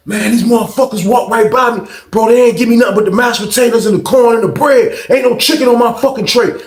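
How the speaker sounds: high, hollered grievance; acted angry complaint. Hungry inmate griping about his tray, mock angry holler. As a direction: rant through the wall, imitating outrage.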